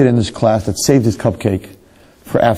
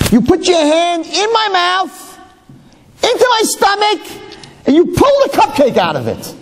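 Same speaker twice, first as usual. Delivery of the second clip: retold scream. Rabbi mimicking his own outraged scream at the class. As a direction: shriek it like a wronged kid. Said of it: "I remember screaming".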